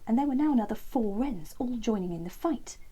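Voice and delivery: ordinary delivery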